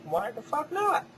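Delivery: mock-defiant reply to the critics; defiant retort. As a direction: shoot it back at the critics. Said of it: "And I'm like".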